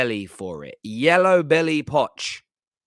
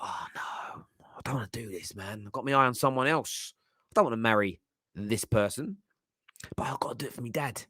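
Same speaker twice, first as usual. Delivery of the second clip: mock whisper; 5 semitones lower, 6 dB quieter than usual. Son reluctant about forced marriage.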